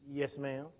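small, low, soft answer; meek son. Retold or imitated. Son owning up to mama on the phone, meek. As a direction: answer mama meekly and very quietly.

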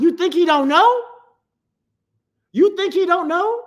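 high, loud, incredulous question; incredulous challenge. Real, not acted. Preacher answering a scandalized objector, incredulous challenge. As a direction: challenge them, voice climbing on the question.

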